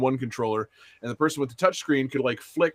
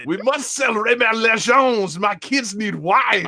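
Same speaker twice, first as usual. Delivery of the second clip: put-on slow, higher hammy French accent; 7 semitones higher, 4 dB louder, slower than usual. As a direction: ham it up like a French chef. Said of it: "Oh, speaking French?".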